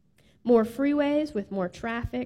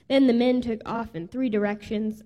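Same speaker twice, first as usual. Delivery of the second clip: plain reading voice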